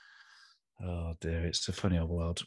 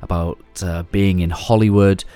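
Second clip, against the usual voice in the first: polished, slightly louder announcer read over music; podcast intro read; 10 dB louder than usual. Acted. Host introducing the episode and guest to listeners, announcer read. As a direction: present the guest like a host opening the show.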